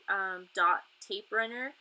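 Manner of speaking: ordinary talk, nothing marked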